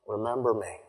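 soft, low and humble; hushed humility; humble plea. Retold or imitated. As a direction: let the plea drop to a quiet, humble request. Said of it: "humility to it".

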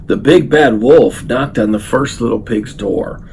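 plain reading voice

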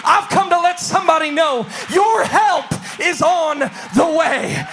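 loud rising preacher cadence; fiery sermon climax; preaching at full force. Acted. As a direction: preach it out to the congregation.

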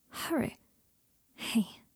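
faint hushed whisper; barely voiced bedside whisper. A narrator's reading. Weak patient at bedside.

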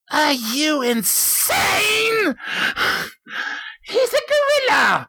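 high, shouted outrage. Acted. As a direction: yell it high in disbelief. Scientist yells about gorilla.

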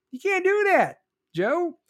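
acted high-pitched scolding; mock protest. District court acted scolding Joe.

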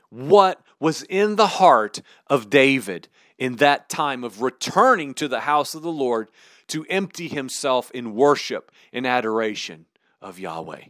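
ordinary talk

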